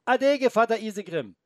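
put-on high, loud boy's call; calling after. The boy calls after the departing wolf, high and loud. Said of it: "rief er dem Wolf noch nach".

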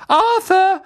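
high, loud wifely shout; calling out. A narrator's reading. Wife summoning her husband to the train, shouting. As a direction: yell for him down the platform. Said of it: "rief Misses Weasley".

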